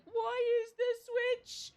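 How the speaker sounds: hammed-up crying rant about missing the dog; mock sobbing. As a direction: whine it out like a blubbering wreck. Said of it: "They're like".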